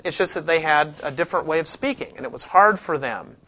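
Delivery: ordinary talk, nothing marked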